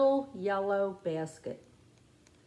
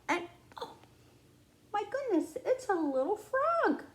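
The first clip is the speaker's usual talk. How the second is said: surprised; 9 semitones higher than usual. Pretend. Frog reveal.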